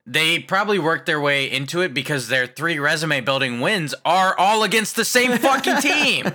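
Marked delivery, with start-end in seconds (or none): 3.6-6.3 exasperated rant climbing high; upset. Real, not acted. Fan venting about losing to one team, upset. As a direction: let the frustration rise to the last word. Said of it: "judging by your upsetness".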